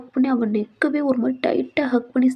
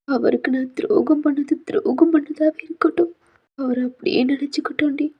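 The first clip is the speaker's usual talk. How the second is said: high, weepy begging for a promise; tearful pleading; 3 semitones higher than usual. Acted. Heroine begs friend for promise. (அழுதுகொண்டே சத்தியம் கேட்டல்)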